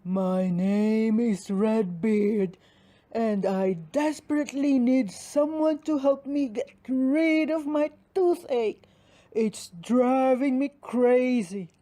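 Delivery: a huge voice trying to be gentle; giant's stage whisper. Acted. Redbeard the giant whispers about his toothache. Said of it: "the giant whispered".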